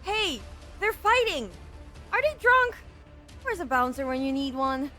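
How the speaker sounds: high-pitched cry of alarm; alarmed calling out. Acted. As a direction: call out in alarm about a brawl. Bystander calls out at fight.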